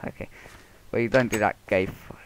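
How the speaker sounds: normal talking voice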